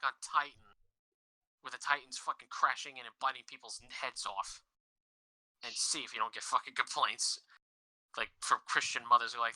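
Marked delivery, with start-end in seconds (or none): none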